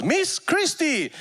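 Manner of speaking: high, slow, stretched-out name call; announcer call. Retold or imitated. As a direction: call her name like a stage host. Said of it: "And he goes".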